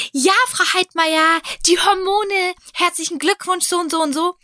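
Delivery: high, slow, chirpy receptionist voice; cheerful receptionist. Retold or imitated. Receptionist congratulates patient on the phone, high and chirpy.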